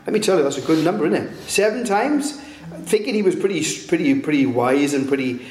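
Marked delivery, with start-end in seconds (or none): 1.5-2.4 higher-pitched questioning offer; hopeful question. Retold or imitated. Peter suggesting a number to Jesus, hopeful question. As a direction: ask it high, as a proud suggestion.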